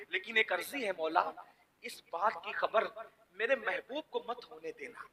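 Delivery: a whispered lover's petition; soft pleading prayer. Acted. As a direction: beg quietly so no one hears. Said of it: "انداز عاشقوں کا دیکھ".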